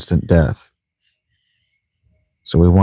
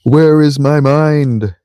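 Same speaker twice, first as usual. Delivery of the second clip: drawn-out musing; 7 semitones higher, 3 dB louder, much slower than usual. Pretend. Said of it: "That's kinda how he says it".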